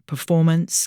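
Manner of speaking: plain reading voice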